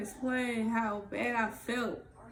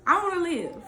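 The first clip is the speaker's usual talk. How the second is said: determined; 7 semitones higher, 8 dB louder than usual. Real, not acted. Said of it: "repeating her resolve".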